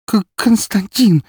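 high, slow, halting plea; loud, faltering, high-pitched appeal; frightened stammer. A narrator's reading. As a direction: stammer the name in fear. Frightened woman stammers to count.

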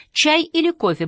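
a hint of a high, inquiring waitress; lightly voiced waitress. A narrator's reading. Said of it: "поинтересовалась официантка".

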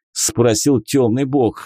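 ordinary delivery

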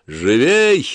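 loud sharp shout; overseer's shout. A narrator's reading. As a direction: yell at the slaves to hurry. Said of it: "раздался окрик надзирателя".

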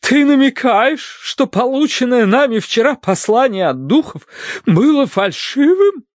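high, grand indignation; majestically affronted wife. A narrator's reading. Wife rises to confront husband, majestically offended. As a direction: draw yourself up and challenge him. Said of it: "величественно поднялась с дивана".

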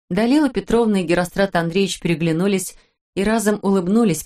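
ordinary delivery, nothing marked

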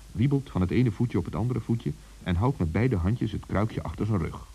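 ordinary delivery, nothing marked

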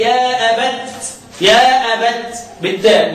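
يا أبت ending on a clear تاء, slowly; stop on taa. Shown as a demonstration. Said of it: "بقية القراء بيقولوا إيه". (الوقف على يا أبت بالتاء)

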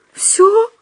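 very high, surprised single word; doubtful question. A narrator's reading. Худяков asks Остап if that suffices, high doubtful question. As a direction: squeak it as an unsure question.

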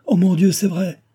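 high, loud cry of realisation; aghast outburst; alarmed exclamation. A narrator's reading. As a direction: blurt it out in sudden panic. Acted alarm at sudden realisation.

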